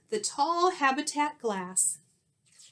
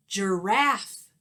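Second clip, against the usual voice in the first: loud, proud answer called out; calling out; 5 dB louder than usual. Acted. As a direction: call the answer out to the kids.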